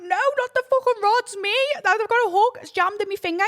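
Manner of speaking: high, loud desperate yelling; retold panicked yell about the hook; frantic shout. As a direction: shout to correct him in a panic. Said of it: "And I went".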